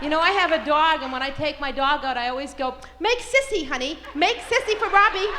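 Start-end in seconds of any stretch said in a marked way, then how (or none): 3.0-5.3 high, loud pet-talk voice; doting dog owner, pitched up; coaxing a dog. Acted. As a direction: coax your dog in singsong. Coaxing her dog to pee.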